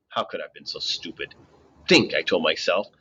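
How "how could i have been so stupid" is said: low, soft groan at himself; muttered self-blame; self-reproach. A narrator's reading. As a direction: kick yourself under your breath.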